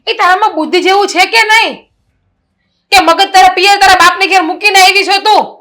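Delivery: put-on sister-in-law scolds the lazy young bride, angry and loud; angry scolding.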